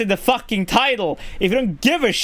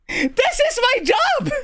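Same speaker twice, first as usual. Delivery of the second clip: furious shout; 12 semitones higher than usual. Real, not acted. Streamer blows up at troll.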